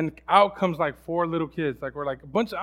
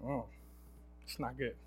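defeated; 5 semitones lower, 8 dB quieter than usual. Retold or imitated. Bad attitude voiced.

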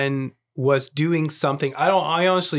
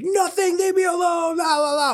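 put-on very high-pitched bratty kid outburst; yelling eight-year-old. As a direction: imitate a child yelling to shut Dad out. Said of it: "immediately started yelling at me and was like".

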